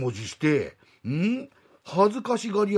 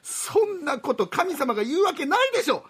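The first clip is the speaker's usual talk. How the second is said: high, sharp mom scolding; scolding mother; 11 semitones higher than usual. Acted. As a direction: tell off a naughty son. Mother retorts.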